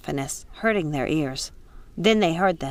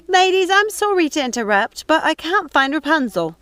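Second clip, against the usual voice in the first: higher, louder polite call; raised apologetic call; calling down politely; 9 semitones higher, 5 dB louder than usual. Acted. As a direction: call down to the ladies below. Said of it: "She called down to the witches".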